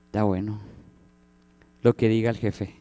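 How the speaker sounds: half-hearted compliance; grudging disciples. Retold or imitated. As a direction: go along with the boss grudgingly. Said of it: "los que iban con Jesús".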